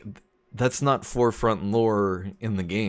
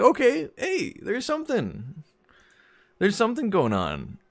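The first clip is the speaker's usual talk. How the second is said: hopeful excitement; 12 semitones higher than usual. Acted. Fan spotting a possible game romance, high and hopeful. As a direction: lift the voice high, perking up at a glimmer.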